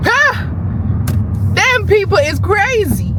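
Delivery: they are crazy, hit it hard; excited rant. Real, not acted. Excited rant about nail people.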